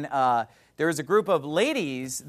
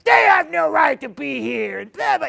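high, loud mock shout; imitated scream; 8 semitones higher, 7 dB louder than usual. Preacher imitating old man screaming at elderly lady, high mock shout. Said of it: "He's just screaming at some elderly lady".

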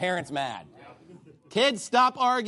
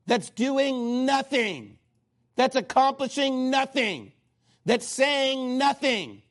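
slow mocking emphasis; 5 semitones higher, slower than usual. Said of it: "mocking the idle rod".

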